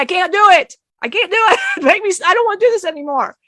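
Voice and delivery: retold desperate protest. Retold breakdown after being shaken.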